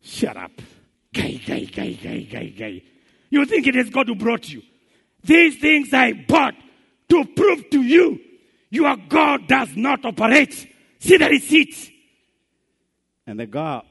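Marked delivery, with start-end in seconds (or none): 0.0-2.8 low, jeering hey-hey; low mocking taunt; mocking neighbor. 3.3-11.9 loud gloating villain; gloating shout. Retold or imitated. Neighbor shouts proof.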